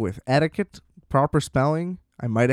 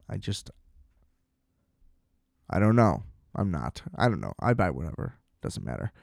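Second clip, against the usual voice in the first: much lower and softer, trailing off; resigned mumble; 7 semitones lower, 8 dB quieter than usual. Real, not acted. Giving up on the rant, a defeated low mumble. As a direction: let it fade out in defeated resignation.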